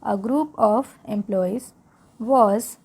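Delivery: slow, careful read-aloud for learners; English read-aloud for Hindi-speaking students, slow. Shown as a demonstration. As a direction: read it slowly so learners can follow along. Reading text slowly for learners.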